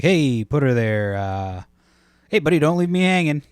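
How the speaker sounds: loud, high, overeager buddy voice; mock hearty bro. Pleading with the guy who ignored the handshake, hearty. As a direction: ham up a desperate handshake plea. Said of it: "Be like".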